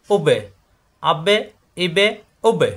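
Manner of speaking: উবে, আবে, ইবে with a bounced ব; qalqalah bounce. Shown as a demonstration. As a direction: push off the ব in উবে, আবে, ইবে each time. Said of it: "ধাক্কা খাবে প্রত্যেকবার".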